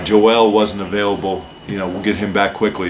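ordinary delivery

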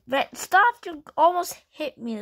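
play-scold a careless driver; mock scolding. Pretend quarrel over bad driving.

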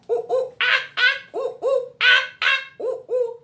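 high, repeated monkey hoots; monkey call. Shown as a demonstration. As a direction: hoot and screech like a playful monkey. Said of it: "and monkeys is".

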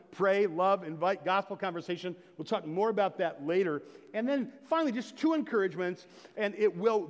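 fervent preaching. Acted. Pastor urging his church to pray, fervent preaching. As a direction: drive the points home to the pews.

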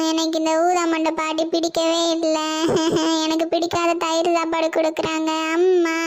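put-on whiny little boy tattling; a kid complaining to himself; child voice. Boy complains about grandma.

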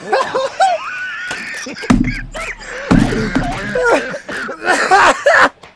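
long, high wailing laugh; real hysterical high laughing; hysterical laughter. Real, not acted. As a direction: laugh till it turns to shrieks. Losing it at the game.

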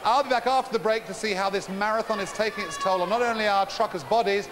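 ordinary delivery

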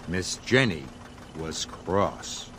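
ordinary delivery

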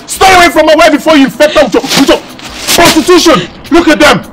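loud, indignant husband laying down the law; stern angry warning. Acted. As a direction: warn them off your wife forcefully. Husband warns two women off wife.

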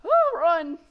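high loud panicked shout; panicking gamer. Real, not acted. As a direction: yell it while fleeing. Fleeing the chaser.

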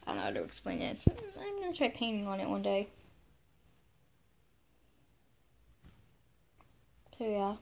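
talking while chewing gum; words round a wad of gum. Real, not acted. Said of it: "Sorry, I'm chewing gum".

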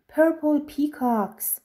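usual speaking voice

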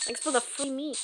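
thanks in an odd, softened voice; weird-sounding voice. Real, not acted. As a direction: let the line come out sounding weird. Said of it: "that sounded, that sounded weird".

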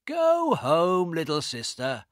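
loud sneering brother; mocking dismissal. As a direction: brush her off with scorn. Carl dismisses his sister.

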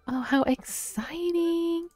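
high, slow thrilled coo; excited cooing. Acted. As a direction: stretch it out high with delight. Reacting to opened present.

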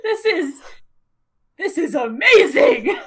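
loud, laughing delight; excited shout. Real, not acted. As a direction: cry out in pure joy. Flying for the first time.

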